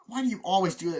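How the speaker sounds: loud, high acted complaint; exasperated. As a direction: demand it in mock annoyance. Acts quarrel in car.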